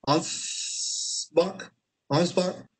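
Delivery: trying out "Ansbach" with a questioning rise; hesitant attempt at saying "Ansbach"; tentative pronunciation. Real, not acted. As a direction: sound out "Ansbach" carefully, unsure of it. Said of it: "I think it's how you say it".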